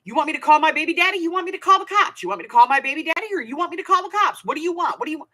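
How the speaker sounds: high, fast mock rant; imitated yelling. Pregnant woman yelling at a guy in a truck, imitated yelling. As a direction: fire off threats like an angry woman yelling. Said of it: "She was yelling".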